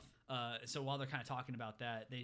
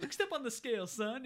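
high, loud put-on command; imitated bossy trainer; mock trainer order; 10 semitones higher, 6 dB louder than usual. Acted trainer ordering kid onto scale.

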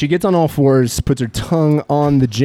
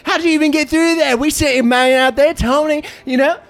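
swagger as a wasteland boss with a wobbly Australian twang; a hint of an Australian accent; British-Australian accent; 7 semitones higher than usual. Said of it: "You sounded British at the start".

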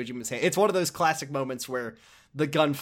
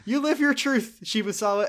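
high, loud cheerful encouragement; mock cheering doctor; 9 semitones higher, 6 dB louder than usual. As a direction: cheer him on, hammed-up. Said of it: "the doctor's like".

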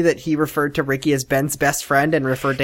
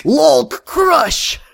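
loud, higher Lulk crush; hulk roar; 10 semitones higher, 4 dB louder than usual. Pretend. Cohost voicing the Lulk smashing, loud and high. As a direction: bellow like a smashing monster.

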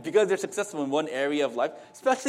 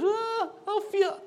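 high, drawn-out mock groan; slow, whiny put-on reluctance; sluggish mock groan; slower than usual. Mocking government workers who don't meet deadlines, sluggish mock groan.

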